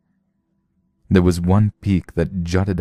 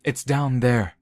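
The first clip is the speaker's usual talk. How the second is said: rapidly; 7 semitones higher, 5 dB quieter than usual. Acted. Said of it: "Olam said rapidly".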